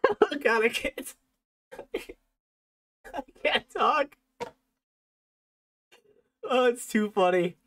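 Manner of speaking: very high, squeaky with laughter; laughing so hard the words barely get out; helpless real giggles. Real, not acted. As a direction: wheeze the words out between fits of giggles. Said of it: "having the giggles now".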